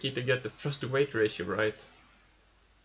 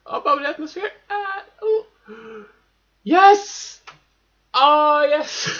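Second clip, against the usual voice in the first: loud high shout of triumph; triumphant shouting; 14 semitones higher, 10 dB louder, slower than usual. Real, not acted. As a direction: burst out in victorious yells. Reaching orbit on last fuel.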